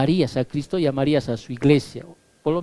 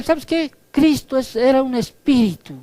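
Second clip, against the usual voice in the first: lightly voiced smug false teacher, higher; mocking false teacher; 7 semitones higher than usual. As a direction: toss the heresy off like it's obvious. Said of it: "A estos que decían". (burla ligera de un falso maestro, más agudo)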